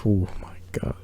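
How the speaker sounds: breathy awed whisper. Real, not acted. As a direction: whisper it in disbelief. Awe at the sled's weight.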